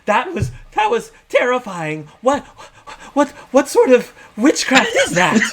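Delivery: high and loud, half laughing; rattled and spooked; high shaken laughing voice. Acted. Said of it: "So energy kinda says".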